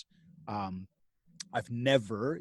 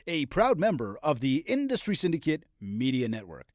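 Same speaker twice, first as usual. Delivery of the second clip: big, upbeat podcast announcer intro; 3 semitones higher, 3 dB louder than usual. Acted. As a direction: sell the show intro like a radio host. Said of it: "I really need to step up my announcer voice so that I sound that good".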